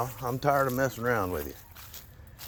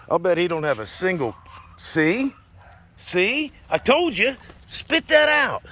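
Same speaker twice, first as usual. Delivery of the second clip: much higher, louder scolding of the dog; 7 semitones higher, 9 dB louder, slower than usual. Real, not acted. Owner scolding his dog for eating a bird, loud. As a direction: yell at the dog to drop it.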